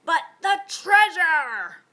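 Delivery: loud, slow hollered protest; captain's anguished holler. A narrator's reading. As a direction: holler it in dismay. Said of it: "hollered Braidbeard".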